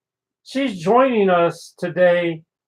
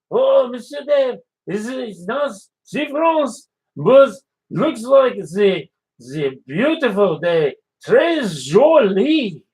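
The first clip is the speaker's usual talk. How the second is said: put-on French chef accent; 3 semitones higher than usual. French chef greeting Dave on the morning show, in a French accent. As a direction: play the cheerful French chef, accent laid on thick.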